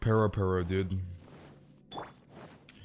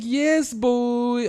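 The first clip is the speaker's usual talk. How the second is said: fake girl voice; 11 semitones higher, 5 dB louder than usual. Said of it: "he's like".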